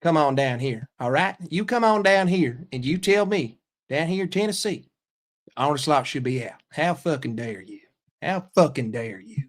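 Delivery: mock-offended Southerner's accent; Southern accent. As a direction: put on a challenging Tennessee accent. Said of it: "There's your Southern accent".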